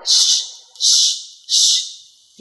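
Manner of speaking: "sh" as a long, heavy hushed hiss; heavy SH sound. Shown as a demonstration. Teacher showing learners the English sound, heavy drawn-out "sh". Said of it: "âm sờ nặng hơn một chút".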